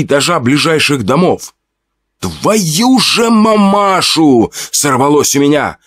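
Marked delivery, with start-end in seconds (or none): 2.2-4.5 high, slow, drawn-out shocked curse; a stunned, stretched-out "Твою же мамашу!"; shocked swearing. Acted. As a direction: blurt it out at the sight of the monster. Swears at giant spider.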